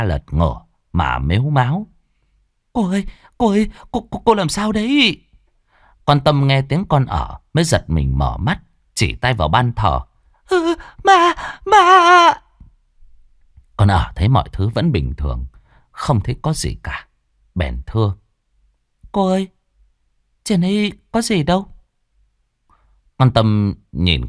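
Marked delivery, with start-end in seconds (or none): 2.7-5.2 put-on high, anxious young maid; high servant voice, worried; servant girl voice. 10.5-12.3 very high, loud frightened cry; terrified whimper. Acted. Terrified mistress points at altar. 19.1-21.7 put-on high, anxious young maid; high servant voice, worried; servant girl voice.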